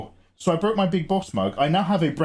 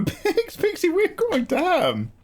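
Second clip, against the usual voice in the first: squeaky voice; slower than usual. Acted. Squeaky mock reaction.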